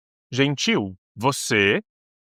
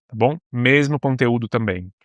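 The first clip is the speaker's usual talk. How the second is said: ordinary delivery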